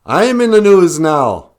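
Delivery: high, loud mock excitement, hollering; hammed-up gawking viewer, high and loud; excited viewers. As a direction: crow it like a thrilled viewer on the news. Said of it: "They go".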